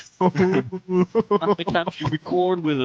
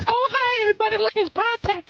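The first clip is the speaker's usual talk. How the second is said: much higher, squealing excited greeting; "I'm excited to be here" voice. Pretend. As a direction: gush like an overexcited fan. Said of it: "I'm excited to be here" voice".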